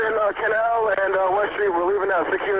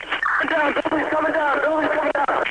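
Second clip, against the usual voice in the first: high, urgent panicked yelling; panicked shout; 10 semitones higher than usual. Real, not acted. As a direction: shout the warning in real panic. Tower collapsing during radio call.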